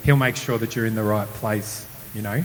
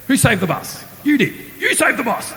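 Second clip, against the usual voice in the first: pump-up corner man; 10 semitones higher than usual. Retold or imitated. Counsellor pumping up the boy like Rocky's corner man. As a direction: fire him up like a boxing coach. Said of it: "like he was Rocky's corner man".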